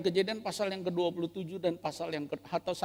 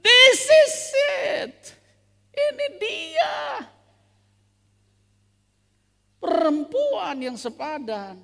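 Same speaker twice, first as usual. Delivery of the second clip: much higher, loud joyful cry, slow on the last lines; ecstatic shout; 15 dB louder, much slower than usual. Acted. Adam waking to see the woman, shouting with joy. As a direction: shout for joy at finally finding her. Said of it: "waktu Adam melek bangun bilang".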